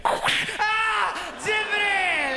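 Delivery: high, drawn-out comic cry; mock scream. Comic imagining what was gonna happen, high mock scream. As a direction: wail the name up high.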